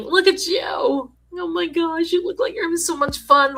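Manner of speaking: high, gushing delight at a viewer; excited gushing. Real, not acted. Host gushing to Tina over her fun photo, excited and high. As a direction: squeal over her photo, drawing out oh my gosh.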